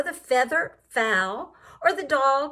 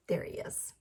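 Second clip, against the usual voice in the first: whisper; 14 dB quieter, faster than usual. A narrator's reading. Pointing out the hidden flea.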